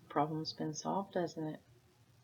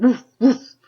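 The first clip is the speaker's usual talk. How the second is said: barked loud woof, woof like a big dog; 6 semitones higher, 18 dB louder than usual. Acted. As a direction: bark it out loud. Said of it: "The dog said".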